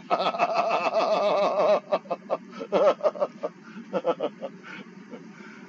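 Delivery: real, long rolling laughter. Real, not acted. Teacher laughing at his joke about eating, long laugh. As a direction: laugh on and on, gradually dying away.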